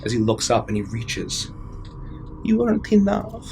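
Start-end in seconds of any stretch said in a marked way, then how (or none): 2.4-3.5 put-on high, faint little girl voice; a lost daughter's cold accusation; ghostly child voice.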